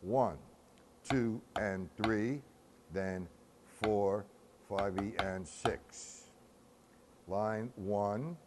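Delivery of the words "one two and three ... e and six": slow, evenly measured count; drawn-out rhythmic counting; breakdown count. Shown as a demonstration. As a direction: count out each beat slowly, in strict time.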